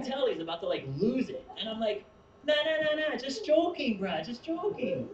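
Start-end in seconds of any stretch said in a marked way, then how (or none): 2.4-5.1 high, loud, laughing "just joking"; laughing backpedal. Retold or imitated. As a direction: quickly take the joke back, grinning. Backpedalling joke to worker.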